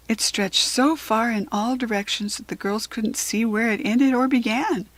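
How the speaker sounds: ordinary delivery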